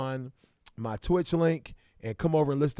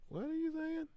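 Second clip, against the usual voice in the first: mock baffled squeak; 6 dB quieter than usual. Baffled by a viewer's comment.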